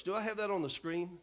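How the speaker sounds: usual speaking voice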